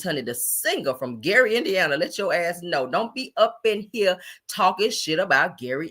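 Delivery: usual speaking voice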